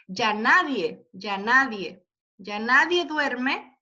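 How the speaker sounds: nadie slowed with weight on the NA syllable; teacher scanning the verse to show the stressed syllable. Shown as a demonstration. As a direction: tap out nadie slowly, leaning on NA. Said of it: "Yo creo que la carga la recibe aquí".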